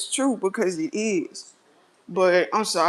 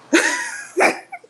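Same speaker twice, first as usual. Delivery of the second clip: sudden high shriek of a laugh; high-pitched laugh; 4 dB louder than usual. Real, not acted. Laugh after calling out.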